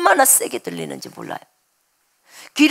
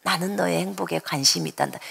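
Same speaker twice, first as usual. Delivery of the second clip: gentle soft voice; 6 semitones lower, 7 dB quieter than usual. Retold or imitated. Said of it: "이 성령님의 음성은 진짜 부드러워요".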